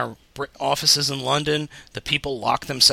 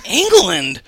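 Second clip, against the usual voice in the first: retold disbelief, higher and louder; incredulous; 6 semitones higher, 8 dB louder than usual. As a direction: echo it back in mock disbelief. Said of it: "I went".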